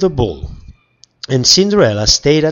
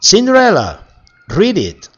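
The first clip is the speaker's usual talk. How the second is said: high, loud call; 9 semitones higher, 5 dB louder than usual. Acted. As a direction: yell her name across the house. Stepmother shouts for Cinderella.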